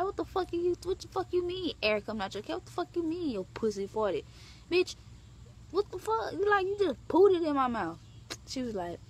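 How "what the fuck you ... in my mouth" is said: high, indignant ranting; high-pitched indignant tirade; disgusted rant. Retold or imitated. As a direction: lay into her in disgust. Ranting at partner.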